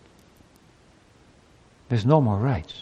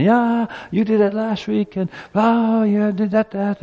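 mock quarrelling; 7 semitones higher than usual. Spouses dredging up last week's conflict, mock quarrelling. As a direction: whine out old grievances in a raised voice. Acts out a couple's renewed quarrel.

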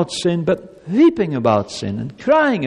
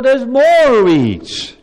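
slow, loud, weighted on "more"; drawn out; 7 semitones higher, 7 dB louder, much slower than usual. Acted. Pastor hammering the garden image, slow emphasis. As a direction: stretch each word of "there's more weeds".

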